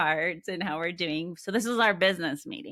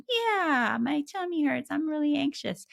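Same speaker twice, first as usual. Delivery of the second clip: put-on higher, child-like voice admitting worry; child's voice; 9 semitones higher, 3 dB louder than usual. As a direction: play a little kid with a tummy ache. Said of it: "And they go".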